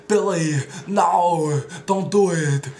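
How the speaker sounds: Arnold shout. Retold or imitated. Arnold warning Billy, loud slow shout. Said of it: "those are my Arnold impressions".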